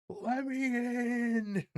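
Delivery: very high, slow plea; high falsetto plea. Retold or imitated. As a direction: whine it slowly in falsetto. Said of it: "Eric Andre meme".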